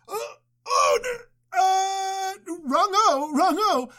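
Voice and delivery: high-pitched flustered stammer; mock panic. Husband corrects the men about his wife, mock panic. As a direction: stumble into a squeaky, hasty correction.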